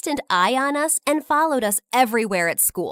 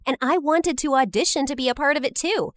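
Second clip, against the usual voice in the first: ordinary delivery, nothing marked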